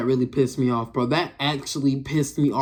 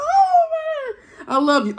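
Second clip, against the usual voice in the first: exasperated outburst; 4 dB louder, slower than usual. Real, not acted. Exasperated affection for drivers.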